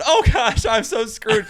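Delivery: high, loud panicked wail; panicked dread. Retold or imitated. A boy watching the fire spread, high panicked wail. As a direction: squeal it like everything is falling apart. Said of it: "And I'm just going".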